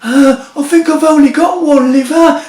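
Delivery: put-on high, tearful old man's quaver; a weak old uncle calling from the back room; frail voice. A narrator's reading. Uncle Albert calling from the back room, frail and tearful. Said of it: "comes a frail voice".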